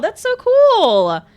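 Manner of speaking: high, loud, drawn-out gush; excited praise. Real, not acted. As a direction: stretch it out in loud delight. Praising a viewer's fan art.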